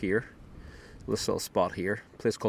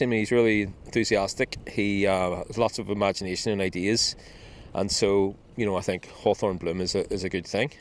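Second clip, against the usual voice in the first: put-on louder puppet voice in a Northern Ireland accent, mouth covered; 3 dB louder than usual. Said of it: "They all speak in a Northern Ireland accent".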